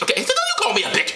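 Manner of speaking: very high falsetto retort; high-pitched mock indignation; offended Mary. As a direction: snap back in a comic woman's falsetto. Acting Mary objecting to insult.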